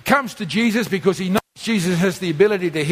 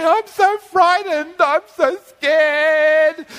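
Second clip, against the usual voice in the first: high, drawn-out whine; mock whining complaint; 6 semitones higher, much slower than usual. Preacher imitating believers grumbling to God, mock fearful whining. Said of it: "complaining and grumbling all day".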